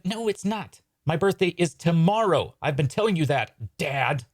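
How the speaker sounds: put-on high, exasperated child voice; protesting kid. Son correcting Dad about his birthday, exasperated.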